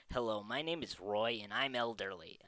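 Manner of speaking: usual speaking voice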